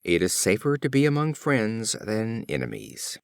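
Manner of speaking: usual speaking voice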